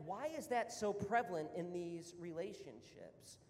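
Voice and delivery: ordinary talk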